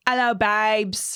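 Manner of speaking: drawn-out attempt at a trashy Essex accent. Shown as a demonstration. Host attempts the listener's requested impersonation, trashy Essex accent. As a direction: try a Cockney-like Essex greeting, stretched out slow. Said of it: "go for it with an impersonation".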